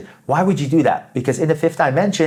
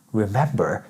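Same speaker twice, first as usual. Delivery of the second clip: quiet, lower, puzzled echo; 6 semitones lower, 6 dB quieter than usual. Retold or imitated. Hearing the word remember, low confused echo. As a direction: repeat the word like it makes no sense. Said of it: "You're gonna be like".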